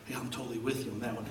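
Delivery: soft, fast, confiding mutter; a churchgoer sidling up with quiet, quick support; hushed aside. Retold or imitated. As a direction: slip it in quietly like a secret. Whispered support in parking lot.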